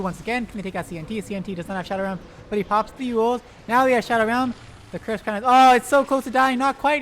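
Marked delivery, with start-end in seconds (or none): 5.5-7.0 louder, higher rush of excitement; real caster excitement; excited shout. Real, not acted. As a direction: call the near-kill at full volume. Hero nearly dies, caster reacts.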